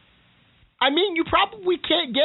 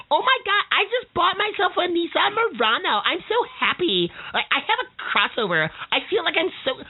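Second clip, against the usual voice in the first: high, gushing valley-girl mimic; mock the giddy crossover buyer; imitating a giddy new owner, pitched way up; 7 semitones higher than usual. Mocking crossover buyer again.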